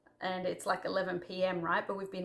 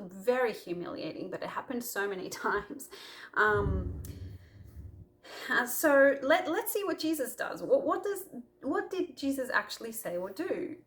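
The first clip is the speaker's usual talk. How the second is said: normal talking voice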